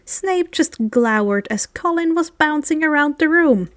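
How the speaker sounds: ordinary delivery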